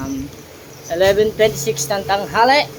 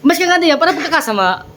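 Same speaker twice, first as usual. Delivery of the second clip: excited; 10 semitones higher, 5 dB louder than usual. Real, not acted. Teasing loud exclamation.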